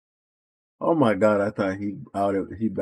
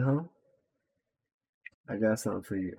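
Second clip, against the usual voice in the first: ordinary talk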